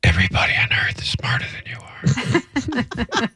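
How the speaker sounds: quick, unvoiced scornful whisper; imitated wife's whisper. Wife berating husband all the way home, whispering. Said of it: "She whispered like that all the way home".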